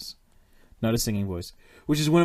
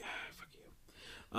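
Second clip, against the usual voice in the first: a very soft, voiceless exhaled curse; whispered curse; 22 dB quieter, much slower than usual. Acted. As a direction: whisper it under the breath. Muttered aside quote, wholly unvoiced.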